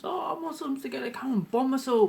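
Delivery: high whiny scaremongering voice; mocking Pauline Hanson. Mocking a politician harping about Muslims, high and whiny. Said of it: "now she's harping on about Muslims".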